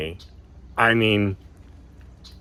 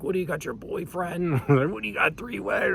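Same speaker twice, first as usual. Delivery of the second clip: high, softer mock taunt with laughter; mouthing off; 7 semitones higher, 6 dB quieter than usual. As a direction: imitate your own jeering, cracking up as you go. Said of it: "I'm mouthing off".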